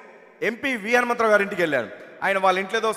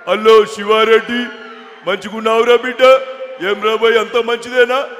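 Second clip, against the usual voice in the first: loud, drawn-out mimicry of an elder politician; V Hanumantha Rao; 8 dB louder, slower than usual. Politician greeting the comedian at his house, loud mimicked voice. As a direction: boom out a familiar, stretched greeting. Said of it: "Hanumantharao గారి bit ఒకటి చెప్పేసి వెళ్ళిపోతాను".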